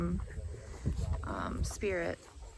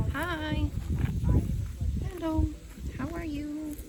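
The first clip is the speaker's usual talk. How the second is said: higher sing-song hello to the cow; cooing greeting; 8 semitones higher, slower than usual. Real, not acted. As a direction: greet the cow sweetly, up high.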